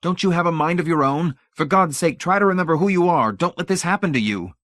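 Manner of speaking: high, loud impassioned plea; desperate roar. A narrator's reading. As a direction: roar at him to come to his senses. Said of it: "Hamilton roared at the bewildered old man".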